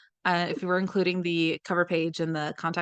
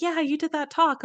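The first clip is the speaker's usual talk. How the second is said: a high, bright-eyed fan voice; mock eager fan, pitched up; imitating fans; 9 semitones higher than usual. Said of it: "where they're like".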